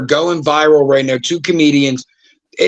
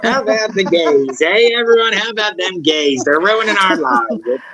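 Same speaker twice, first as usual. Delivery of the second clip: hammed-up rabble-rouser, pitched up in bursts; mock bigoted rant; 4 semitones higher than usual. Podcaster mimicking the host he refuses to be, mock ranting. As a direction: call out like a parody hate-monger, slowing at the end.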